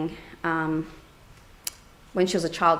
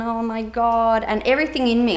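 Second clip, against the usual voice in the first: normal talking voice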